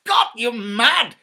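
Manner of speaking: very loud, high and slow horrified outburst; aghast cry. Acted. Husband cries out to his wife, loud and aghast. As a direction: recoil in horror. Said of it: "he cried aghast".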